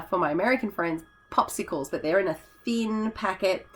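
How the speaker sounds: ordinary talk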